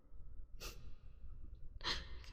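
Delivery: hushed, breathy villain giggle; quiet evil laugh. Acted. Vampire delighting in the entranced hunter, hushed evil laugh. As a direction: chuckle under the breath in triumph.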